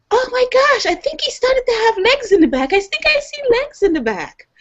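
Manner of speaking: high, thrilled discovery of legs; excited child. Retold or imitated. Daughter in the car seeing tadpole legs, high excited child. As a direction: gasp it out like a kid who spots legs.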